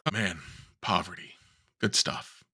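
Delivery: dry, low mock enthusiasm; deadpan sarcasm about a bleak book; sarcastic. Real, not acted. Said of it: "Sarcasm much?".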